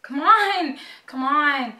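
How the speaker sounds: loud, high, drawn-out cry; exasperated urging. Real, not acted. Fan waiting on a loading page, loudly exasperated. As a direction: cry out at it impatiently.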